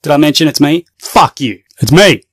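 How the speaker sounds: loud, repeated angry jabs; hammed-up indignant rant; worked-up outburst. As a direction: lash out loudly in short bursts. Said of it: "Calm down".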